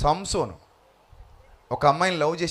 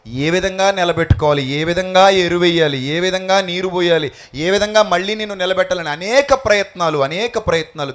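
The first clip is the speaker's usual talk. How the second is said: louder, each ఏ విధంగా stressed; preaching build; 2 semitones higher, 5 dB louder than usual. Acted. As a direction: stack the questions like a preacher. Preaching list at full force.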